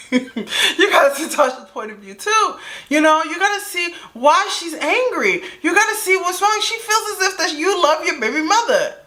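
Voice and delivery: high, reasoning mediator voice; friend playing peacemaker. Friend on the bus talks him down, high and reasoning. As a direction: coax him to see her side. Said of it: "my friend's like".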